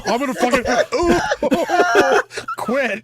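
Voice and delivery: breaking into laughter mid-sentence; words collapsing into real laughter; laughing hard. Real, not acted. Cracking up after his co-host threatens to quit, laughing.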